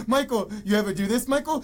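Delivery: a hint of a pushy journalist; lightly mocking journalist; taunting repeat of name. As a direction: needle him with his name. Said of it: "he keeps saying his name".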